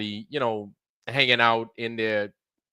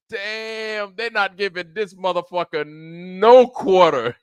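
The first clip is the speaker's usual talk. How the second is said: amused disbelief; 9 semitones higher, 7 dB louder, slower than usual. Retold or imitated. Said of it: "And I was like".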